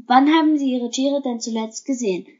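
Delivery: ordinary delivery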